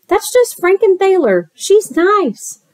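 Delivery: high, sing-song character voice; reassuring monster character. Acted. Monster friend tells the scared kid not to worry, high-voiced reassurance. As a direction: reassure a child in a high storybook voice.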